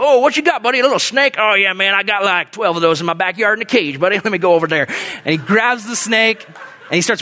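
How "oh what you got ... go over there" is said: hardcore biker neighbor, unfazed and eager; Danny. Acted. Snake-wrangling neighbor answering a plea for help, cocky character voice. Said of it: "And he's all".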